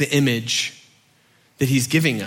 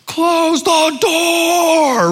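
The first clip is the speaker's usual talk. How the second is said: drawn-out, high-pitched parental holler; imitated yelling parent, slow and high; 14 semitones higher, much slower than usual. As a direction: holler it long, as if after a kid running outside. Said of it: "My parents would yell".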